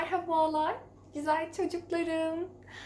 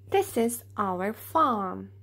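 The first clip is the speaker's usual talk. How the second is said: ordinary delivery, nothing marked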